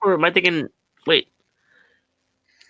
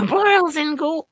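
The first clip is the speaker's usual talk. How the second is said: higher Cryptkeeper try; 9 semitones higher, slower than usual. Shown as a demonstration. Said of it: "The Creeper?".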